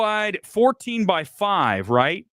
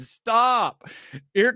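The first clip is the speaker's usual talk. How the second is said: normal talking voice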